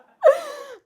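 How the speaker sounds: high burst of real laughter; loud laugh. Real, not acted. Laughing at the friend's awkward-video joke, high laugh.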